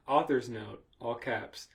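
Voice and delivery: ordinary talk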